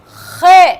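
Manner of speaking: खे said with a loud rasping kh from the throat; खे with a scraped, guttural kh; letter name kha. Shown as a demonstration. Teacher drills the Russian letter: खे, loud and guttural. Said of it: "इसका sound है केएचए".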